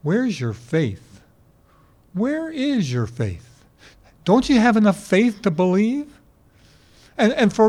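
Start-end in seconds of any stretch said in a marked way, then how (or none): 0.0-6.1 slow searching question. Retold or imitated. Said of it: "to really say to them".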